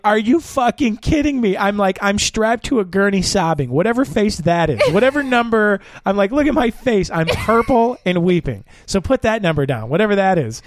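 high-pitched, indignant retelling; exasperated outburst. Retold or imitated. Retold outburst on the gurney.

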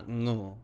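ngu starting with ng; Thai ngu. Shown as a demonstration. Teaching the Thai word for snake. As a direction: begin ngu on an ng sound. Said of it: "in Thai you will".

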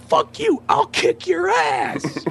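very high-pitched drawn-out yell; retold shouted threat. Drunk woman threatening the host, high angry shout. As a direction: imitate a furious woman threatening a fight.

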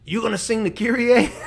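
high, loud imitation of a Filipino priest's accent. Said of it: "he had a very strong accent".

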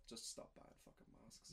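slow whispered aside; slow, breathy whispered emphasis. Retold or imitated. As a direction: whisper it like a blunt secret. Retelling the podcast's blunt advice.

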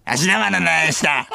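put-on crude child-star caricature; 芦田愛菜. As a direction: sign off in a silly put-on kid voice. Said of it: "モノマネ。". (芦田愛菜のものまねで、変な子ども声)

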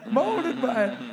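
Bane voice. Acted. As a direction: hit "Molded by it" firmer on the retake. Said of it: "this is the Bane accent one".

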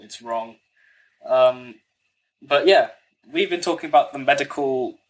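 ordinary delivery

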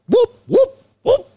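very high, short whoops; Chris Berman. Acted. Host tacks on another Chris Berman bit, high whooping impression. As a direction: yelp each one like a highlight sound effect. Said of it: "It is Chris Berman".

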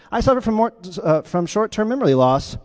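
high, chirpy cartoon confession; Dory. Dory confessing her forgetfulness, chirpy. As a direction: pitch lifted, cheerfully owning up. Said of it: "Her voice is Ellen DeGeneres".

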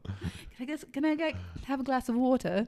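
put-on soft, tentative English-accented request; careful practice. Missionary practising the request, softly in English accent. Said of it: "companionship study is like".

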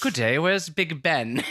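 American badly faking a British tourist; bad British accent. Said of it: "talked in that accent the whole time".

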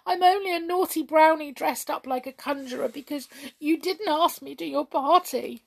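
high-pitched, plaintive confession; tearful wail. A narrator's reading. Hop confessing to the king, tearful. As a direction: plead it through tears, voice lifted. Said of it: "big tears beginning to pour".

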